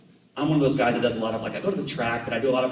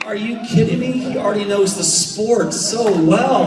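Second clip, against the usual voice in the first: much higher and louder, laughing in amazement; laughing disbelief; 10 semitones higher, 7 dB louder than usual. Retold or imitated. Dad laughing at his son's remark, high and loud. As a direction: burst out laughing at the kid's logic. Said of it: "was like".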